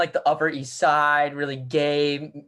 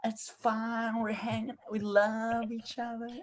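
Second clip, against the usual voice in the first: slow, soft, high and dreamy; blissed-out Reed; 5 semitones higher, 8 dB quieter than usual. Retold or imitated. Reed telling a coworker the candle is fine, slow and dreamy. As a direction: shrug it off all serene. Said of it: "he's just like".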